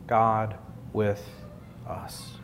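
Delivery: softly drops to a whisper on us; hushed. Real, not acted. Preacher to congregation, hushed. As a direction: let the phrase fade to a hush. Sermon climax, dropping to whisper.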